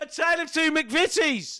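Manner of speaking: high, slow proclamation of a pun; gleeful mock announcement. Co-host topping the title puns, gleeful announcing. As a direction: announce the joke title with laughing triumph.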